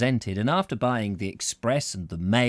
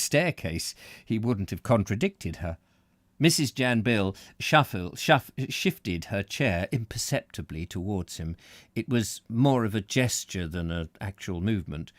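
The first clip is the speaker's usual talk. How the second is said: plain reading voice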